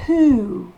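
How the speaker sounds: "Who" with a long ou and a breathy h. Shown as a demonstration. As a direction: stretch the ou in "Who". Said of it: "ou long". ("Who" avec un ou long)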